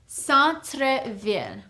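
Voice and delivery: Centre-ville slowed, nasal "san-truh-veel"; Centre-ville, careful French model for learners; slow teaching repeat. Shown as a demonstration.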